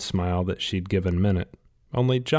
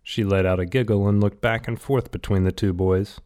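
usual speaking voice

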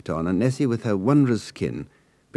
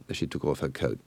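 ordinary delivery, nothing marked

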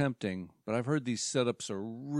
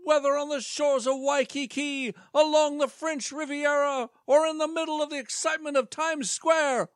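high, upbeat pitchman voice; salesman voice; 13 semitones higher, 5 dB louder than usual. Acted. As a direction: pitch it like a cheesy presenter.

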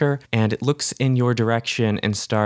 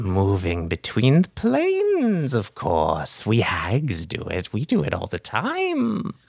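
put-on cackling old hag. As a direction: sweet-talk the cat like a creepy old hag. Hag explains mist walking.

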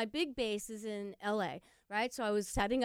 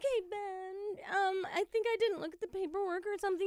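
sheepish question; 8 semitones higher than usual. Retold or imitated. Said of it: "I'm like".

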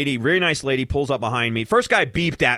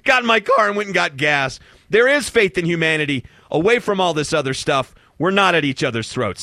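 uplifted; 7 semitones higher, 5 dB louder than usual. Real, not acted. Rising uplift at story's end.

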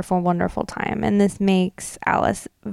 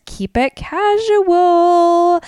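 slow, higher, breezy voicing of the boss; breezy boss quote; 9 semitones higher, 4 dB louder, much slower than usual. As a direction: draw it out like a relaxed hostess setting the tone. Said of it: "but Shelia says".

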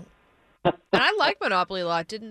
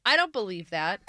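ordinary talk

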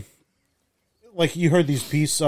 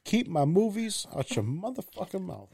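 mock [name]; 5 semitones higher, 8 dB quieter than usual. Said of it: "I'm sorry".

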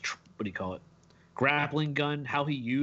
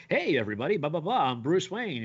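breezy public persona, a bit raised and singsong; Bruce Wayne; 3 semitones higher, 3 dB louder than usual. Retold or imitated. Fan imitating Kevin Conroy's civilian voice, breezy persona. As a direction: imitate a carefree playboy greeting the crowd. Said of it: "When he does his Bruce Wayne".

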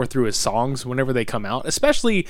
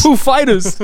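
laughing; 11 semitones higher, 7 dB louder, slower than usual. Real, not acted. Repeats joke band name, laughing.

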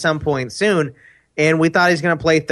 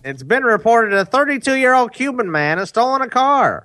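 mock newscaster, higher pitched; news reporter voice; 9 semitones higher than usual. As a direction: read it like a TV crime report. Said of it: "You sounded like a reporter".

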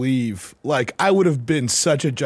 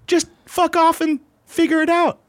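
dismissive mock; 11 semitones higher than usual. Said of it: "you're like".

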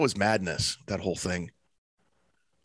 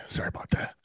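whispering; 8 dB quieter, faster than usual. Real, not acted. Whispered apology.

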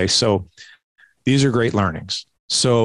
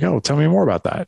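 lifted, gentle tell me more; inviting dad; 7 semitones higher than usual. Retold or imitated. Father coaxing his son after a bad test, warm and open. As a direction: coax him to open up. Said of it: "I just be like".

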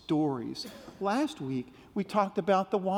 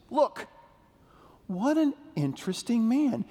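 bright, high call to look; high, excited call to the children; 6 semitones higher than usual. Acted. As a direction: point it out with wide-eyed wonder. Calling kids to look.

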